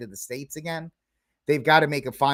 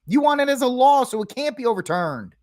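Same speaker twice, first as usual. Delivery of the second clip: raised insistent voice; 10 semitones higher, 5 dB louder than usual. Retold or imitated. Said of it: "And I'm going".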